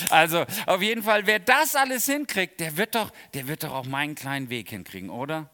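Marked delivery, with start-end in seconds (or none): 0.1-3.1 much higher, loud burst of enthusiasm; real enthusiasm about the weather; excited. Real, not acted. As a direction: let the wonder burst out. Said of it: "Ich finde das manchmal begeisternd".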